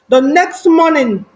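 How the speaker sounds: ordinary delivery, nothing marked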